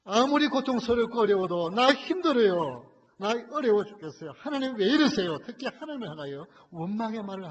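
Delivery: loud, high impassioned sermon voice; full-force preaching. Acted. Preacher pleads loudly with the congregation about Job. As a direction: drive the question home to the congregation.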